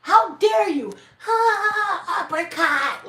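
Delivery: high, loud mock outrage; hammed-up little fighter's battle cry; indignant outburst. Little elf striking back at the giant, indignant.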